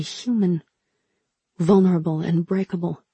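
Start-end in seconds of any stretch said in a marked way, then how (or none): none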